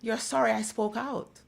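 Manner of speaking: loud, high contradiction; vexed retort. Retold or imitated. Answering the crying sympathizers, vexed and loud. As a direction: throw their apology back at them, indignant.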